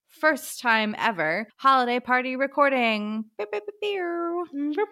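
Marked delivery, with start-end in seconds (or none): 3.4-4.9 high, slow sing-song beeping; playful beeps. Pretend. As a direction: beep it like a little horn. Sound-effect celebration beeps.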